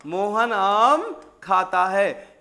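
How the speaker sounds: very slow, each word stretched out; slow dictation. Shown as a demonstration. Teacher dictating the example sentence to students, very slowly. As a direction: spell the example sentence out for the class. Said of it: "ये sentence लिखा मैंने आपके सामने".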